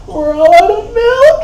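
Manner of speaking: high, slow wail; a pregnant ex in tears over milk; mock wailing pregnant ex. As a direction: wail it like a crying pregnant woman. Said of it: "she's like".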